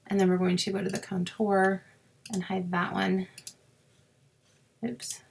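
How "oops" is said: ordinary talk, nothing marked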